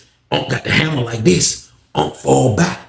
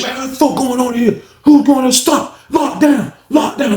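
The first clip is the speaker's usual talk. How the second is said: shouted orders; 11 semitones higher than usual. Retold or imitated. Officers storming in, shouting lockdown.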